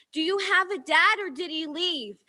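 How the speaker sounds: ordinary delivery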